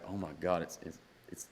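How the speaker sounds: hushed, stammering awe, retold; awestruck. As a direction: fade into a starstruck stammer. Retold awe at seeing his idol.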